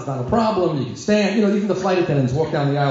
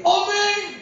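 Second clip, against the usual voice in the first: high amen; 14 semitones higher than usual. Retold or imitated. Joining the prayer.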